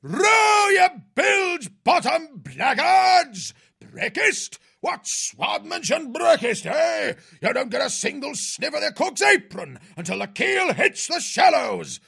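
high, drawn-out bellowed insults; harassing the crew. A narrator's reading. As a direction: drive the rowers on with roared abuse. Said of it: "still harassing the crew to action".

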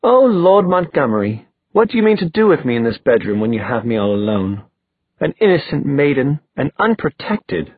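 high-pitched opening, mock-helpless maiden; hammed-up innocent maiden in distress; dramatic damsel. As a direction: play a swooning romance heroine. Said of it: "laid a hand dramatically against her forehead".

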